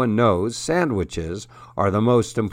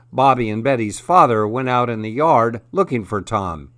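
ordinary delivery

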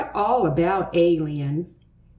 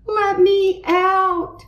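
loud, high, drawn-out plea; screamed; 13 semitones higher, 5 dB louder, slower than usual. A narrator's reading. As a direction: scream slowly and desperately to get free. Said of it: "screamed Buster".

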